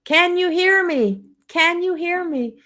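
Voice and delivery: put-on high, drawn-out toddler call; little child's voice. Grandma retelling the little voice in the church, high child's call. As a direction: sing it out high like a small child. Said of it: "I can hear this little voice going".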